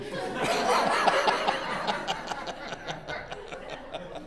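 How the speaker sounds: a long unvoiced laugh, high and falling; long breathy laugh. Real, not acted. Listener laughs at the teacher's story, a long breathy laugh. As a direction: let a breathless laugh roll out.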